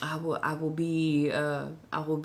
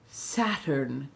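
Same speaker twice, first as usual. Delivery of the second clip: ordinary talk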